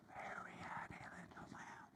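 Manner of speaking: very soft whisper on the in-breath; inhaled whisper. Shown as a demonstration. Teacher whispering on the inhale for the class. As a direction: draw the words in as you breathe. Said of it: "Can you try it inhaling?".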